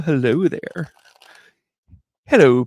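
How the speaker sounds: ordinary talk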